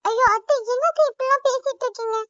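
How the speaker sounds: high, loud, frightened protest; alarmed cry. Acted. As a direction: cry out in fear at her threat to die. Aunt threatens to die. (பயத்துடன் உரக்கக் கூவுதல்)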